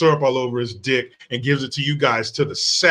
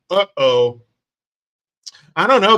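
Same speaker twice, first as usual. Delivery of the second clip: usual speaking voice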